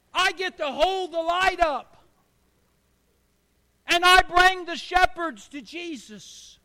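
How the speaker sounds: put-on a kid announcing he is the star; boy telling his mom he's the star; proud excited boy.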